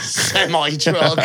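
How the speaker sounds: drawn-out American accent, imitating; mock American. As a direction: echo it in a slow American accent. Echoes the bad American impression.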